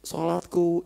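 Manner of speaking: voiceless whisper; whispered tearful answer. Retold or imitated. Anas weeping as he answers his friends, whispered. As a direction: breathe it out through tears.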